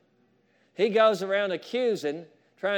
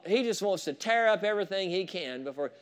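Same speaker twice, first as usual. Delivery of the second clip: ordinary talk, nothing marked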